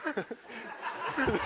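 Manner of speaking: soft laugh at himself; brief laugh after his joke; short laugh. Real, not acted. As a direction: chuckle at the joke.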